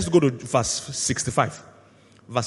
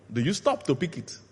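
usual speaking voice

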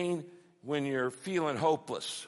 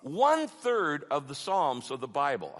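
ordinary talk

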